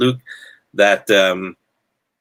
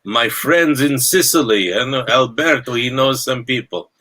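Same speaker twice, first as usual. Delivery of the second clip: put-on Italian accent; 4 semitones higher than usual. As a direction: ham up a shaky Italian mafia accent that slides toward Bulgarian. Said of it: "that didn't even sound Italian".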